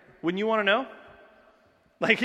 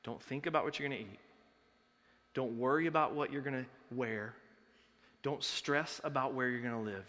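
softer, calm and reassuring; gentle reassurance; 3 semitones lower, 5 dB quieter than usual. Retold or imitated. Jesus calmly reassuring the anxious crowd on the hill. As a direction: soothe them, hushed and steady. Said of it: "he says".

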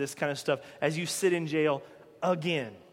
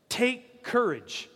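"Take courage," drawn out slowly, both words held; slow emphasis; 4 semitones higher, much slower than usual. Shown as a demonstration. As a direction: lean on "Take courage," like a firm order. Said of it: "understand what he's saying".

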